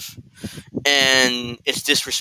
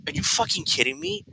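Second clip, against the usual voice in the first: high, incredulous disbelief, much higher; can't believe what he just heard; 14 semitones higher, 5 dB quieter than usual. Real, not acted. Outraged at streamer's claim.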